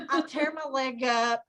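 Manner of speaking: normal talking voice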